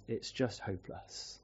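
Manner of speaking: quiet, broken voice of a lonely man; tearful despair. Retold or imitated. Lonely neighbour telling the pastor, tearful and quiet. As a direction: let it crack, hopeless and alone. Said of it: "He broke down in tears".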